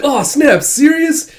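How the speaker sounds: high, loud gushing, slowing at the end; excited fan. Retold or imitated. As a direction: melt over the cute cat. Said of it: "It's like".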